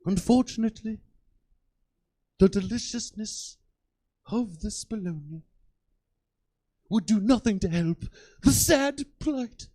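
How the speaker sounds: grave nature documentary narration of a stoner; David Attenborough narrating. Retold or imitated. Comic imagining Attenborough narrating his life as a stoner. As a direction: narrate my day like a wildlife film. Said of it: "but with David Attenborough, imagine".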